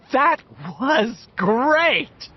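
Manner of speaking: high, drawn-out thrilled cry; elated. A narrator's reading. Beverly hugging her friends after the escape, elated. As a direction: stretch each word with glee.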